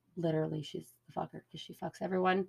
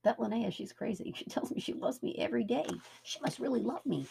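brainwashed voice; 5 semitones higher than usual. Retold or imitated. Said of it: "Get it?".

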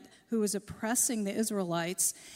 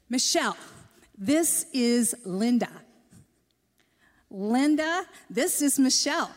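over-clear introduction; 4 dB louder than usual. Retold or imitated. Introducing to woman thought hard of hearing.

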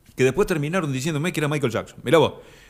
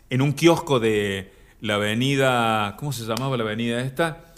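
ordinary talk

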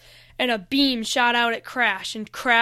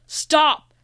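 commanding call; 5 semitones higher, 7 dB louder than usual. A narrator's reading.

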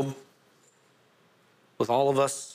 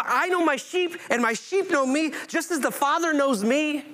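emphatic scripture reading; 10 semitones higher than usual. Acted. Preacher re-reads verse, raised.

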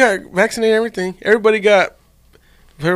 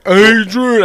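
loud, higher movie-hero cry; Rocky; 5 semitones higher, 7 dB louder than usual. Acted. Showing friends his movie impression, Rocky. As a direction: call it out loud and high. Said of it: "I can do Rocky".

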